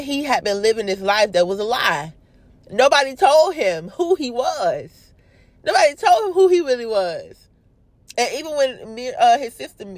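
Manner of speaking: ordinary talk, nothing marked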